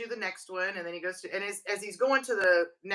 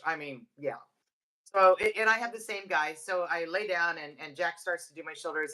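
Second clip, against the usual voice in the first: usual speaking voice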